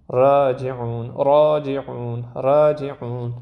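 راجعون with a thin, light ر, dialect style; slow راجعون with the ر made light; Arabic-style light R. Shown as a demonstration. Teacher imitating an Arabic dialect's light R. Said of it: "این سبکه".

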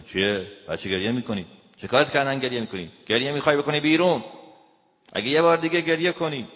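loud, fast angry scolding, building in pitch; attacking. Acted. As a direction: go on the attack, rising to a threat. (پرخاشگرانه، تند و بلند)

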